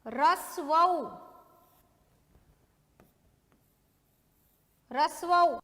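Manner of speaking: रसवऊ बहुत धीमे, खींचकर; रसवऊ को लिखवाने की तरह धीरे बोलो; dictation repeat. Shown as a demonstration. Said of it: "मैं वर्ड वापस से बोल रही हूँ". (रसवऊ धीमा स्पष्ट उच्चारण)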